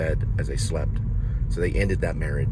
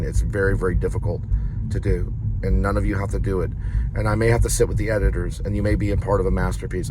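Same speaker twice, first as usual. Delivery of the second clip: ordinary talk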